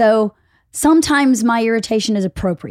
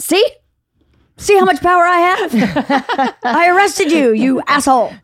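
gloating triumph; 8 semitones higher, 5 dB louder than usual. Retold or imitated. Crowing at the mugger who robbed her, higher, louder and gloating. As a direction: crow over the arrested mugger, laughing. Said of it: "And I went".

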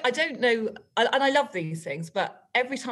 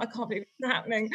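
ordinary talk

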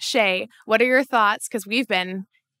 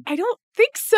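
retold hesitant denial; 10 semitones higher than usual. Retelling her answer to a teammate, high hesitant denial. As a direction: stretch it out high, amused.

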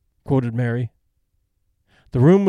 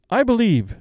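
sudden; 7 semitones higher than usual. A narrator's reading. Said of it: "said Miss Fowler suddenly".

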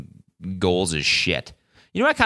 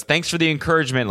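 quick, higher-pitched sarcastic retort; 7 semitones higher, faster than usual. Retold or imitated. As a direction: imitate a friend's sarcastic thanks. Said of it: "like, sarcastically".

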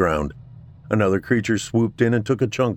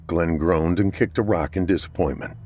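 usual speaking voice

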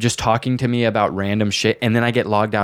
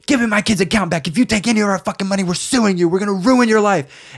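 furious high-pitched yell; angry mom screaming at the scammer kid; 10 semitones higher than usual. Retold or imitated. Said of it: "Like, screaming at him".

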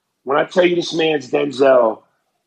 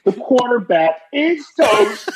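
laughing shout; 10 semitones higher than usual. Retold or imitated. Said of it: "I laughingly shouted".

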